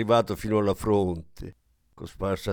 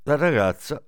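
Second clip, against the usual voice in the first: ordinary delivery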